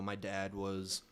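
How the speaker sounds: usual speaking voice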